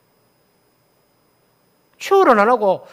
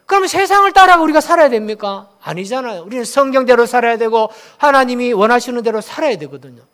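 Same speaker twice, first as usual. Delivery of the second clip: normal talking voice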